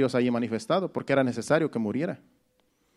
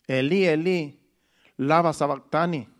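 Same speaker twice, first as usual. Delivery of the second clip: slow, drawn-out anguished cry to God; a mild retold cry of despair; slower than usual. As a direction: cry out the old words slowly, torn. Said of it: "Jesús clamó a gran voz".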